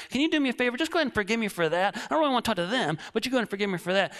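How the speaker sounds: fast, breezy brush-off request; rushed mock prayer. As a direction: rattle it off to get it over with. Mock prayer asking easy forgiveness.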